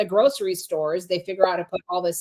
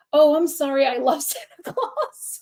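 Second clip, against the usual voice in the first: mock sorry child; 7 semitones higher than usual. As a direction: imitate the son sweetly taking it back. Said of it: "he's like".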